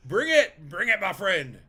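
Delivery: loud, high mock challenge; playful taunt. Streamer daring a player to attack, loud playful taunt.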